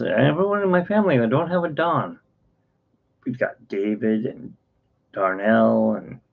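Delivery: patient's explanation. Retold or imitated. Retells patient listing his family.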